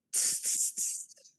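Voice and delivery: soft unvoiced tss tss from cheeky monkeys; cheeky monkeys tsking at the peddler. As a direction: hiss back a mocking tss. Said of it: "monkeys ... said".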